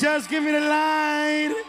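calling out. Real, not acted. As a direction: shout it slowly across the room. Calling for the spotlight.

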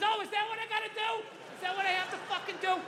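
high, exasperated sarcastic yell; mock screaming rant. Dad fed up with TV buttons, mock screaming rant. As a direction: rant at a stupid machine. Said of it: "Don't scream like that".

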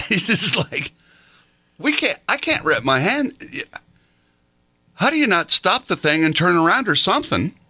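high, laughing exasperation; laughing disbelief. Real, not acted.

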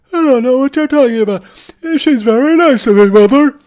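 put-on a son defending a girl to mother; hammed-up whining Norman; Norman Bates impression. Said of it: "Bates Motel reboot".

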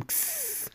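mouth-made factory steam hiss; sharp hissed steam sound effect. Retold or imitated. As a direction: imitate a short burst of steam. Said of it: "That's a steam".

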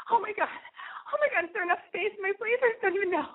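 sangat tinggi, cepat, panik yang dibuat-buat; mock panic. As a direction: tirukan diri sendiri yang panik, nada melengking tinggi. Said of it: "I was, like, really freaking out".

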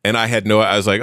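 ordinary talk

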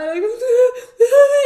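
high loud laugh-sob. Real, not acted. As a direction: burst out half crying. Risa llorosa. (risa llorosa aguda)

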